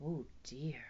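soft, low whisper; hushed dismay. A narrator's reading. Storyteller reacts to the T-Rex, hushed dismay. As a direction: whisper it in mock worry.

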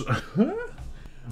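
short high laugh; amused laugh. Real, not acted. Streamer hearing their deepened voice, short high laugh. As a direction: burst of baffled laughter.

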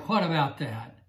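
normal talking voice

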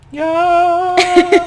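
shouted cheer high and loud; excited shout. Real, not acted. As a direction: yell it out in triumph.

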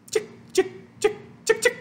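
tiny, high, whispery clicks; quick high shaker sounds; sprinkling noises. A narrator's reading. Reader imitates sprinkling sugar on clay, sprinkling noises.

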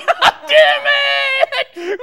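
loud, drawn-out screamed curse; furious scream. Acted. Losing it on set, screamed fury. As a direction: stretch it into a roar of frustration.

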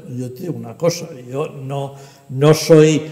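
ordinary delivery

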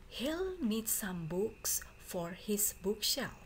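ordinary talk, nothing marked

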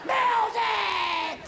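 high shrieking witch yell. Retold or imitated. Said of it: "she turns into [name] going".

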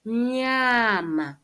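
Mnyama with a long nasal start; pronunciation demo. Shown as a demonstration. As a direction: model Mnyama slowly, falling.

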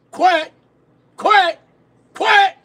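loud, high, repeated duck quacks. Pretend. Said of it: "And now he a duck".

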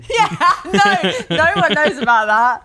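high, loud, laughing through the words; real embarrassed laughter while talking. Real, not acted. Friends recalling her wedding blunder, helpless laughing.

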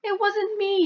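put-on high, pleading child; squeaky protest of innocence; little boy denying it; innocent kid voice. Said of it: "you'll explain".

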